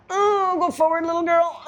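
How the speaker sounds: high sing-song coax to a dog. Real, not acted. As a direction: coax her forward, voice up high. Coaxing the dog forward.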